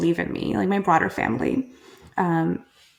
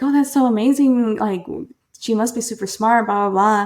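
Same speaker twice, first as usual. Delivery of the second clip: higher, louder mock gushing; imitated amazement; 3 semitones higher, 5 dB louder than usual. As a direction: gush like impressed acquaintances, lightly hammed-up. Acts out impressed people reacting.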